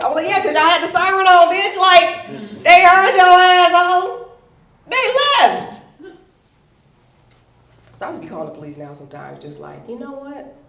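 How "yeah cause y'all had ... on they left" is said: high, loud, drawn-out rant; exasperated shouting. Retold or imitated. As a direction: yell it at the dispatcher in outrage. Angry retort to dispatcher.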